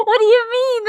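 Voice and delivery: high, loud astonished question; acting out a shocked listener; incredulous. Neighbour astonished at her bedtime excuse.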